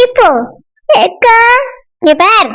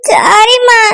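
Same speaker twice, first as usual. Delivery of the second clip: tearful apology; much slower than usual. Acted. Girl begs forgiveness from parents.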